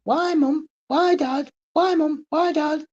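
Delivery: put-on high, whiny child's voice repeating why; child asking why. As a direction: play a pestering little kid. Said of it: "Children ask it".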